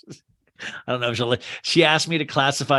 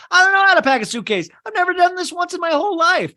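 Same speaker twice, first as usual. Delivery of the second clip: put-on high, fast panicked kid voice; whiny kid Kevin; 14 semitones higher, 5 dB louder, faster than usual. Kid protesting to his mom, high and panicked. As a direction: squeal it like a frantic eight-year-old. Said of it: "He goes".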